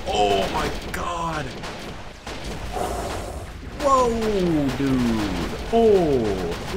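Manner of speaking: slow, drawn-out amazed exclamations; stretched-out stunned wows; stunned awe. Real, not acted. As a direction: start high and let each word sag in awe. Awed by gunfire on the bridge.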